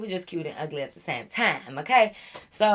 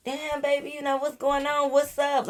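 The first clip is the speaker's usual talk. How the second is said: higher mock flirty voice; mimicking flirty guys; 8 semitones higher than usual. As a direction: ham up the guys hitting on them. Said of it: "they like".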